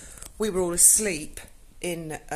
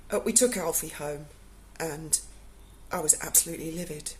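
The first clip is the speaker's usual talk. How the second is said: normal talking voice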